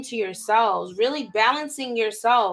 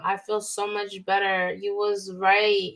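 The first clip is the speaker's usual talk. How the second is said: ordinary delivery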